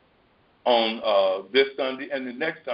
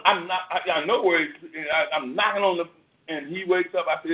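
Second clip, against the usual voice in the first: usual speaking voice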